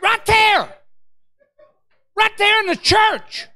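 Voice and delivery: high, loud, slow holler; preacher's high shout; emphatic exclamation. Real, not acted. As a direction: exclaim it in indignant disbelief. Exclaiming over public rebuke.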